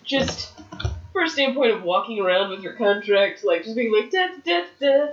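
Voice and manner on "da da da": mocking singsong. Mocking wrestler with contract.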